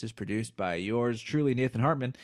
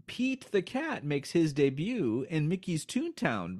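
normal talking voice

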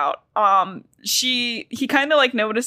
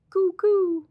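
playful high coup; 9 semitones higher than usual. Pretend. Playing with the word coup.

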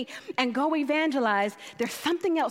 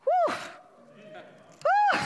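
high whew. Real, not acted. Overwhelmed by scripture, exclaiming.

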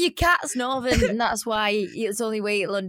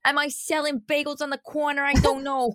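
chatty, improvised New York accent; exaggerated New Yorker patter; 6 semitones higher than usual. Shown as a demonstration. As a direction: ham up a New York accent and keep rambling. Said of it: "do a New York accent".

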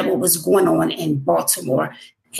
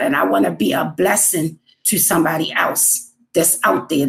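ordinary talk, nothing marked

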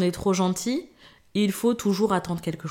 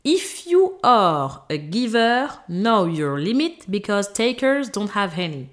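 hammed-up English accent, slow and raised; 2 semitones higher, slower than usual. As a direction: put on her English accent, drawn out. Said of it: "mon accent anglais va sortir".

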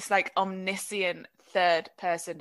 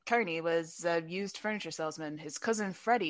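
voiceover narration; 5 dB quieter than usual. Shown as a demonstration. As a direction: read it like a noir voiceover. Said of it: "that was really good... Voiceover".

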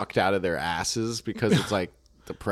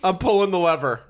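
frantic, high, loud despair; panicked gambling addict; 5 semitones higher, 4 dB louder than usual. Acted. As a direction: scream it like a ruined gambler. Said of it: "my impression of a gambling addict".

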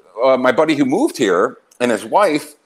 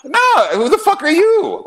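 high, loud, laughing indignation; real laughing, loud and high; laughing outburst; 7 dB louder than usual. Real, not acted. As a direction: laugh it out in disbelief.

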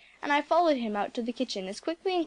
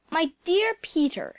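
higher, slow, solemn greeting; grave; 6 semitones higher, 3 dB louder than usual. A narrator's reading. Old doctor finding Peter in the quarantined house, grave. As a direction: sound gravely disappointed in her. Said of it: "he said gravely".

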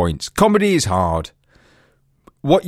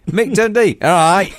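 higher mock version of friend's accent; hammed-up entertainer in a mate's regional accent; Mick Dundee impression; 6 semitones higher than usual. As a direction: put on your mate's accent, pitched up. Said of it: "doing Mick Dundee in your accent".

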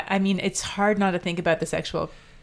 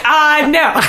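high, loud mock "no" through laughter; raised pitch, loud and laughing; laughing mock refusal; 9 semitones higher, 10 dB louder than usual. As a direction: act out a reply, cracking up.

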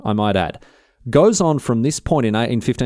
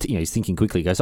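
usual speaking voice

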